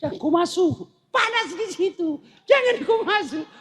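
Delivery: a hint of a panicky villager; mock warning villager. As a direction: call out the warning, hammed up.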